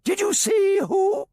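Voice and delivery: very high, slow, loud shriek; very high shouted shrill from Alpha Centauri; shrill excited shout. A narrator's reading. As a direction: shriek it out, desperate to know. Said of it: "shrilled Alpha Centauri excitedly".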